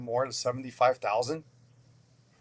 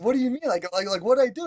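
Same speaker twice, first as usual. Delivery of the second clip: high, baffled retelling of his younger self; incredulous; 9 semitones higher, 3 dB louder, faster than usual. Retold or imitated. Retelling his chef's firing to the host, incredulous and high. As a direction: pitch up as if you can't believe it. Retold shock at chef's dismissal.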